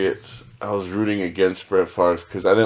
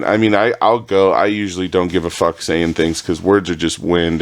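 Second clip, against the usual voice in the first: usual speaking voice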